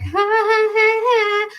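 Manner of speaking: high, loud imitated jeering laughter; put-on sing-song ha-ha; mocking laugh. Everyone making fun of the accent, high mock laugh. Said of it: "everyone made fun of my accent".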